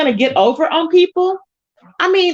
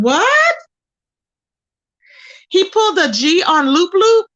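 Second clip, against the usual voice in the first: very high, louder what; stunned disbelief; 6 semitones higher, 3 dB louder than usual. Real, not acted. Learning Custard pulled a gun, stunned disbelief. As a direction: gasp the question out in shock.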